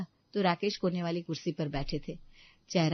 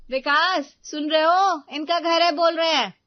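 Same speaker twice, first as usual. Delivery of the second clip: loud, much higher indignant wife; mocking outrage; 11 semitones higher, 11 dB louder than usual. Wife to husband, loud and outraged at his mother. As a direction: call the husband in, scandalised.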